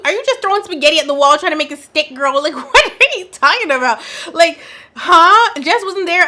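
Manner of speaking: high, loud disbelief, laughing through it; incredulous retort. Retold or imitated. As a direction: act shocked she'd even say that. Recapping Jimmy's reply to Chelsea.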